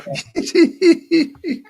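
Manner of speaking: high-pitched, loud giggle; real tittering laugh; amused giggle. Real, not acted. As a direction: burst into a high run of giggles. Laughs at the drinking video remark.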